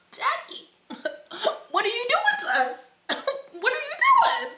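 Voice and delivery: high laughing protest; laughing disbelief. Real, not acted. Vlogger teasing Jackie about her pigment, laughing.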